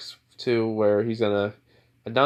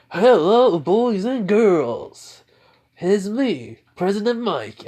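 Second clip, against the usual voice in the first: much higher, louder, stretched-out host voice; hammed-up announcer; 9 semitones higher, 5 dB louder, much slower than usual. President Mike greeting the students, hammed-up announcer. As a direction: boom it out like a showman emcee.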